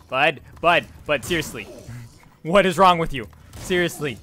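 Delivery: loud, frustrated telling-off; exasperated scolding. Real, not acted. Player to wayward escort character, loud exasperated scolding.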